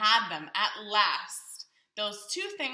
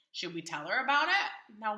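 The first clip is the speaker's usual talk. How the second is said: ordinary delivery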